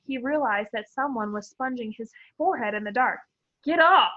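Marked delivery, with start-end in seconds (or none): 3.6-4.1 sharp, loud, higher "Get off"; startled boy shoving a hand away. A narrator's reading. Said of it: "he said loudly".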